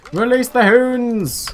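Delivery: a bit Scottish, drawn out high and loud; slight Scottish accent. Acted. Gamer commanding his troops in a Scottish accent. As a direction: bellow the order with a Scottish lilt. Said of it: "I said it slightly Scottishly there".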